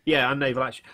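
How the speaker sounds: normal talking voice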